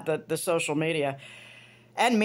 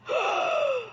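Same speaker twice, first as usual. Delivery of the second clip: shocked gasp. Retold or imitated. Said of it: "He was like".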